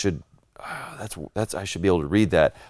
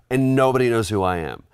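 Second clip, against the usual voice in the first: usual speaking voice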